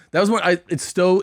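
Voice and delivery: ordinary talk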